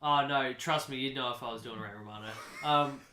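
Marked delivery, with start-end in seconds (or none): none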